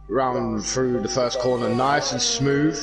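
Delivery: usual speaking voice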